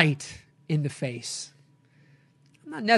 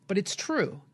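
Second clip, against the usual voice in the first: ordinary delivery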